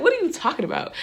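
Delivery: high-pitched, incredulous retort; incredulous protest. Retold or imitated. Driver told she is going to jail, incredulous protest.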